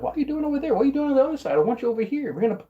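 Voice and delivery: high-pitched mock scolding; imitating nagging manager. Friend manager calling a worker back over, mock scolding.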